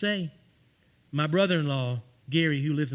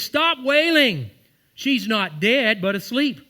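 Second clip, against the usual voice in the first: commanding preaching; 6 semitones higher, 7 dB louder, slower than usual. Retold or imitated. Jesus rebuking mourners, preached.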